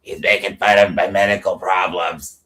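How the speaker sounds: mock hoarse whine; put-on hoarse, aggrieved voice; Christine. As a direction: imitate a croaky woman complaining. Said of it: "and it's like".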